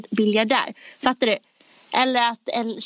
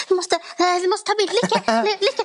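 starstruck; 8 semitones higher than usual. Retold or imitated. Star-struck fan begging friend for a photo, excited. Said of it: "Jag blir så här".

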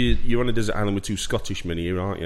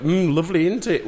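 quoting her delight, higher and louder; mock appreciation; 9 semitones higher, 5 dB louder than usual. Retelling the woman who served the curry, mock delight. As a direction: savour it in a raised, put-on voice. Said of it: "she's going like".

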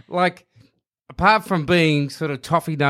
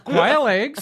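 raised, laughing cry of disbelief; mock outraged surprise; 6 semitones higher than usual. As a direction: play the customer appalled by the plate. Acted shocked cafe customer.

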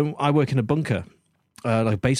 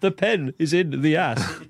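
slow, deliberate mock German accent; put-on German accent, word by word; 3 semitones higher, slower than usual. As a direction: spell it out slowly as a mock German. Mock German phrase-book repeat of joke.

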